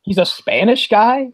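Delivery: slow, high rising question; mock incredulity. Podcaster questioning Connery's Spanish role, mock incredulous. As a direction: draw it out in hammed-up disbelief. Mock disbelief at Highlander's casting.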